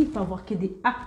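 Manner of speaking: ordinary delivery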